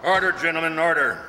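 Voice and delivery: loud gavel-style call for order. Acted. Chief calling the rowdy meeting to order, loud. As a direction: bring the room to order, loud.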